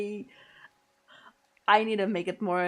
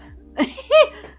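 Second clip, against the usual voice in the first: loud high laugh; 14 dB louder than usual. Real, not acted. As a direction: burst of high squealing laughter. Laughing at own joke.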